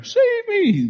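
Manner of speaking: a hammed-up cry for rescue; mock plea. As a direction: play the man begging to be saved. Man asks to be saved.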